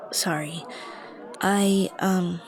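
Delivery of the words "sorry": embarrassed sigh. Real, not acted. Anxious spiral, apologizing to listener.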